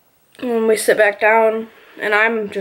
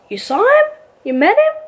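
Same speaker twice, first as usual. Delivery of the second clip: put-on little boy; 13 semitones higher than usual. Son hearing his mother saw his father, high amazed child voice. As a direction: pop up high like a surprised kid. Young son reacting to news.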